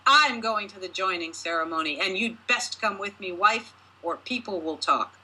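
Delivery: ordinary delivery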